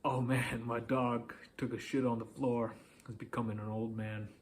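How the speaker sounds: easygoing, confident telling of bad news; smiling confidence. Shown as a demonstration. Coach shows how to share bad news, confidently. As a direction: shrug it off with a smile. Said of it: "with a smile on your face or with confidence".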